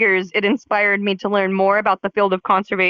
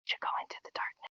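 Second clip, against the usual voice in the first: fast secretive whisper; 19 dB quieter than usual. Acted. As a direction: whisper it like a conspiracy. Sneaky whispered joke.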